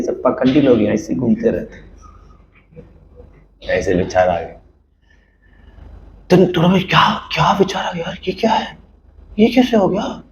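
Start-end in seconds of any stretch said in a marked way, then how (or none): 6.3-10.2 whispered self-reproach; whispered inner panic; guilty whisper. Acted. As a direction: hiss it to yourself in shock.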